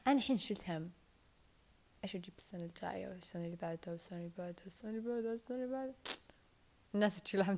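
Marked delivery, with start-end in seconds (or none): 2.0-5.9 fast whispered list, rising at the end; rushed whisper. Real, not acted. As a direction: whisper the endless list quickly. Worrying over gifts for years ahead.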